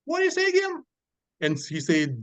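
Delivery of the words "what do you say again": much higher squeaky disbelief; mock indignant. To the customer, high mock indignation. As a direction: act scandalised, voice jumping up. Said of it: "really angry".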